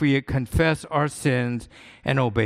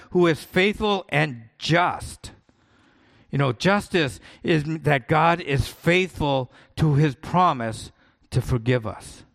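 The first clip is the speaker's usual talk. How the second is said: ordinary talk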